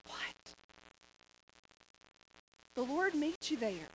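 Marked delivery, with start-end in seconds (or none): 0.1-0.6 breathless whisper; whispered what. Retold or imitated. Worn-out runner whispering in disbelief. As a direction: barely voice it, spent. Exhausted runner's dazed reaction.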